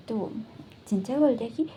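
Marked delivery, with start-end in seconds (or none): none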